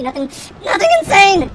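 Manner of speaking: high-pitched burst of glee; giddy outburst. Real, not acted. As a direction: let held-back excitement break out. Glee breaks out again.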